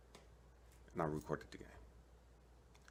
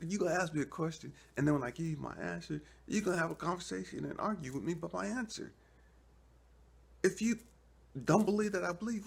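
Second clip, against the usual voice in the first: much higher, louder, fast complaint; exasperated rant; 5 dB louder, faster than usual. Acted. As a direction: vent at God like he's wasting your time. He argues back at God.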